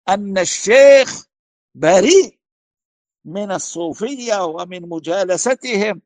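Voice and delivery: loud, emphatic declaration; resounding proclamation. Retold or imitated. Student publicly defending his sheikh, resounding proclamation. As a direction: proclaim it firmly and loudly. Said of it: "وصدعنا بها مدوية".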